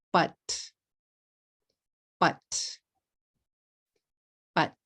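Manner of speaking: "but" said crisply as one syllable, repeated; careful demo. Shown as a demonstration. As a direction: model "but" in one short beat. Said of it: "Only one syllable".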